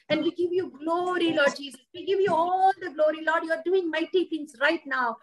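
ordinary talk

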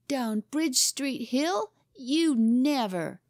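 high, slow, incredulous question; hopeless disbelief. A narrator's reading. Boy hears his rival's biggest boast, hopelessly disbelieving. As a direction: draw it out in beaten disbelief. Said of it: "said Horace hopelessly".

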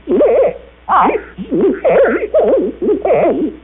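put-on high, quavering old voice; old man voice. Shown as a demonstration. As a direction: sound feeble and old. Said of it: "as old as you sound".

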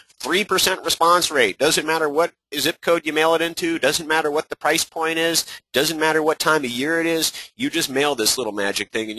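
sarcastic hype pitch; mocking hype. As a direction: parody an overhyped marketing pitch. Mocking grand marketing promises.

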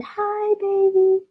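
put-on high, soft cooing baby voice; baby talk. Shown as a demonstration. Preacher shows how adults greet a baby, high sweet coo. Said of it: "you get all soft and cute".

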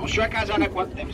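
ordinary talk, nothing marked

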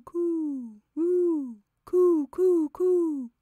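high, drawn-out dove coo. A narrator's reading. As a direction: coo loudly like a dove, gliding down. Narrator voices the cooing doves.

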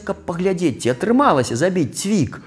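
ordinary delivery, nothing marked

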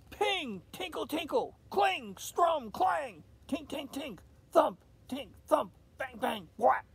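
high, loud imitated clanging instruments; noisy band sounds. A narrator's reading. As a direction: bang out the noises like a toy band. Narrator imitates band noises.

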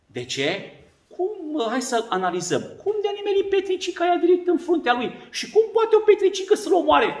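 high, incredulous questioning; can't believe the stone worked; amazed Israelites. Acted. Israelites marvel the pebble killed Goliath, high amazement.

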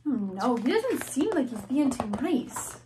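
ordinary delivery, nothing marked

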